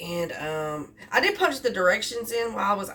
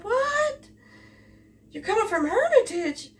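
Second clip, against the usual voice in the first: high, incredulous "What?"; astonished; 13 semitones higher than usual. Retold or imitated. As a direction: mimic her shock at the distance. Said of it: "She said".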